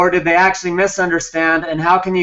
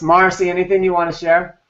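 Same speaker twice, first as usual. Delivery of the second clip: normal talking voice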